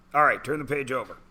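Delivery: ordinary talk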